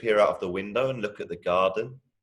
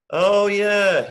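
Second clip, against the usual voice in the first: humouring enthusiasm; 12 semitones higher, 8 dB louder than usual. Acted. Big brother humours him.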